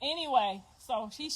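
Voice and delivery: ordinary talk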